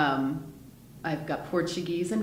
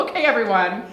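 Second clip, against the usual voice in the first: louder, higher announcement to the group; mock taking charge; 4 semitones higher, 6 dB louder than usual. Actor imagining herself addressing a Christmas Carol rehearsal, mock take-charge. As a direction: call the room to order, half-joking.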